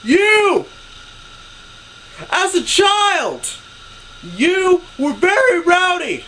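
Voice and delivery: high, slow, booming proclamation yell; full-volume mock public announcement; imitated loudest yell. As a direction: bellow it across a parking lot, drawn out. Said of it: "yelling in his absolute loudest voice".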